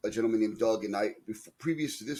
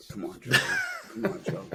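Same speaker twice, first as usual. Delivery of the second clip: laughing. Real, not acted. Teased friend protests, laughing.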